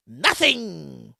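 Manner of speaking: low, soft, drawn-out NOTHING; dropped-voice repeat. Real, not acted. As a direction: let "Nothing" sink and trail away. Drops the repeat for effect.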